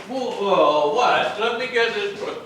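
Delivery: loud, high jump-up whoa; startled whoa. Shown as a demonstration. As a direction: leap up and cut her off loudly. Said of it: "director demonstrates the whoa reaction".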